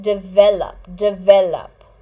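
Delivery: "develop" as di-VEL-up, middle syllable punched; "develop" in three separate beats, di-VEL-up; correct pronunciation. Shown as a demonstration. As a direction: model "develop" carefully, stress on VEL. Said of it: "listen to this very carefully".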